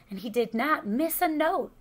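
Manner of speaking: ordinary delivery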